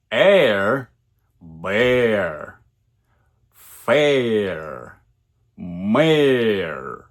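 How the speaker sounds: "air", "bear", "fair", "mare" each with a gliding air diphthong; air diphthong words. Shown as a demonstration. Teacher listing English air words, pronunciation demo. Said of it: "такие слова, как".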